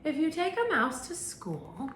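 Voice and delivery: lively read-aloud voice; reading to children. Acted. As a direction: start the story as if the kids are on the rug. Teacher starts reading the story aloud.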